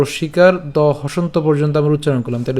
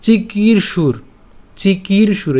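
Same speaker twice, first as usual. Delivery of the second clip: "চিকির সুর" pitched up, second syllable drawn out; pressed long vowel; 4 semitones higher, much slower than usual. Shown as a demonstration. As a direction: stress on "কির" in "চিকির সুর", higher pitch. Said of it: "জোর গলায় বলতে হবে".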